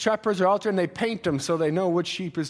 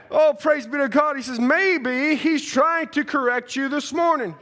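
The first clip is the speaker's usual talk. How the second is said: high, loud, fervent sermon delivery; impassioned revival preacher; full-force preaching; 9 semitones higher, 6 dB louder, slower than usual. Real, not acted. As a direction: preach it out with drawn-out phrase endings.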